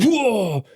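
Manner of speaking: high, loud drawn-out "whoa"; imitated startled whoa. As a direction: mimic a man shocked by a punch. Punched man's reaction, imitated.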